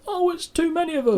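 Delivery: high-pitched mock exasperation; exasperated high voice. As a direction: squeak it out, overwhelmed. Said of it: "and went".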